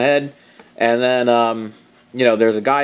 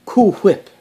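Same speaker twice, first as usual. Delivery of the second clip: imitating Stewie, laughing; 7 semitones higher than usual. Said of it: "on Family Guy when he says".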